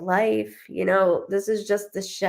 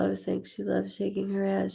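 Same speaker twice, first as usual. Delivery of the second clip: ordinary talk, nothing marked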